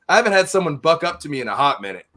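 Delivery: ordinary talk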